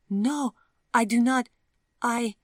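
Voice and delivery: put-on high, loud, nervous young woman; flustered Vivian. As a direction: stammer out the answer, high and flustered.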